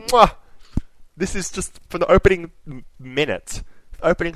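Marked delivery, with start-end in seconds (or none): 0.0-0.3 loud, high kissing mwah; chef's kiss. Real, not acted. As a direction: smack a kiss of delight.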